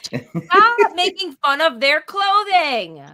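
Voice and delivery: drawn-out teasing scold; playful scolding. Real, not acted.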